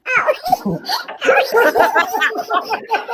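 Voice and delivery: squeaky laughing fit; high helium laugh. Real, not acted. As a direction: giggle very high.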